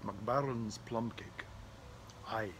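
slow mock Scottish brogue; Scottish accent. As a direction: roll it out like a Highlander. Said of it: "I'm smoking some McBarnes".

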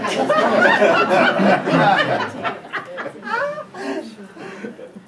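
long, high, loud real laughter; loud burst of laughter. Real, not acted. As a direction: crack up loudly. Laughing at the zombie infographic.

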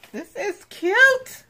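high, drawn-out squeal of delight; slow, high-pitched gush. Real, not acted. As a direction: squeal it loudly, stretching "cute". Gushing over a cute craft.

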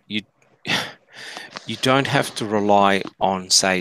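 ordinary talk, nothing marked

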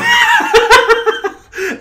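squealing high burst of laughter. Real, not acted. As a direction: crack up mid-line. Laugh breaks first take.

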